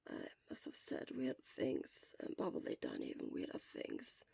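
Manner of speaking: embarrassed sick whisper; hoarse whisper. Acted. Sick Shura whispering weakly.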